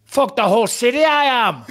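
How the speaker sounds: hammed-up, high Northern Irish boast; Conor McGregor. Host reads McGregor's boast, high mock Northern Irish accent. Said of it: "it's the only Irish accent that I can do".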